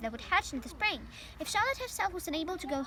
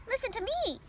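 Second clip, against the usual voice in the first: crying out; 8 semitones higher than usual. A narrator's reading. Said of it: "cried Wilbur".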